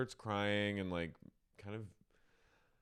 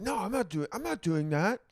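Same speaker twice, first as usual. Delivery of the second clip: high, louder defensive protest; defensive denial; 9 semitones higher, 5 dB louder than usual. Retold or imitated. Schwartz denying he gaslights Joe, high defensive.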